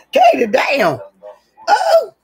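high laughing cry, then a shouted word; laughing outburst. Real, not acted. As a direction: burst out laughing at the dog.

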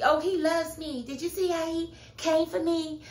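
high, giddy mocking girly voice; high breathless mock gushing; mocking Karen. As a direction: gush like a smug rival.

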